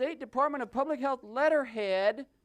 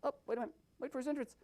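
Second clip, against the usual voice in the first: normal talking voice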